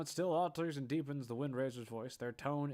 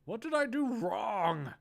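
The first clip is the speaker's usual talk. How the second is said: high, loud, slow cry; sudden outburst; 10 semitones higher, 8 dB louder, slower than usual. Acted. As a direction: blurt it out in distress. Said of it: "they suddenly blurt out loud".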